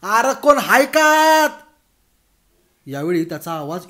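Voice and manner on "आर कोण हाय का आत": high, slow, full-throated shriek. Acted. Bhagwan, fed up, screaming into the house at full pitch. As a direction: scream with everything you've got. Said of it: "त्याने किंकाळी फोडली".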